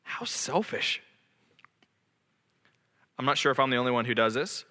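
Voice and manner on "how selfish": hushed, disgusted aside; whispered self-rebuke. Real, not acted. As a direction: breathe it out in shame. Self-rebuke after confession.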